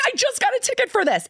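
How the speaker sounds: high, pleading mock protest; exasperated protest. Mom telling second cop she's already ticketed, high whine. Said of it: "I'm like".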